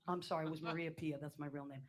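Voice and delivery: ordinary talk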